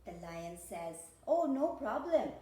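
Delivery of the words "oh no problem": loud, higher, breezy reassurance; eager agreement. Acted. Lion agrees to help monkey draw, eager and loud. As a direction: jump in happy to help.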